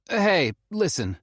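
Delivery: put-on urgent young man on the phone; Felix calling. A narrator's reading. Felix cutting to the point.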